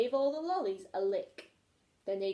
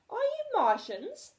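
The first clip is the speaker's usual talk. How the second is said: gasped question, much higher; gasping; 14 semitones higher, 5 dB louder than usual. A narrator's reading. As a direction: gasp it out in amazement. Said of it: "gasped George".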